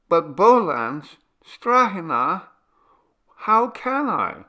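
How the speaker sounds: high, drawn-out wavering plea; hesitant protest. A narrator's reading. Radoshka reluctant to run off with them, high hesitant pleading. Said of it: "act as though she would hesitate".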